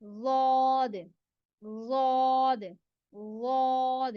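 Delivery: "वाद" very slow, full-mouthed and heavy; heavy model "वाद"; full-mouth demo. Shown as a demonstration. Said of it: "भरे हुए मुंह के साथ पढ़ना है".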